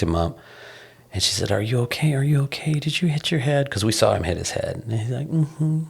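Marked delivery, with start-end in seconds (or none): 1.5-3.7 imitating a mother's soft, higher voice; loving concern. Wife checking on toddler after bumped head, loving concern. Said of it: "was loving and reaching out". 5.3-5.9 put-on small, high, soft toddler hum; imitated toddler. Toddler answering his worried mother, small agreeable hum. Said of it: "the reciprocating tone was".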